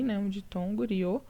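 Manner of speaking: ordinary talk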